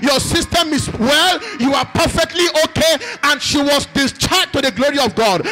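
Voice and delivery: loud, high, fervent preaching; preaching at full force. Acted. Pastor rousing the church, preaching at full force. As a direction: rouse the congregation with every line. Preacher announces healing to congregation.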